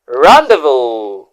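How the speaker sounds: "rendezvous" slowly stretched out as rahn-day-voo; "rendezvous" drawn out with the z and s silent; careful pronunciation. Shown as a demonstration. Teacher recapping the first word for viewers, slow and careful. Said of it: "as you can see on the screen".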